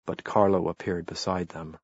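plain reading voice

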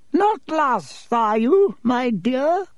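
put-on very high-pitched old witch voice; leering old witch. A narrator's reading. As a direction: creep up sweetly as an aged witch. Aged witch leers at lost boy.

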